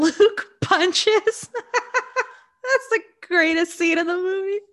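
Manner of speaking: laughing through the words, voice cracking up; can't stop giggling about the punch. Real, not acted. Telling her co-host about the best scene, laughing.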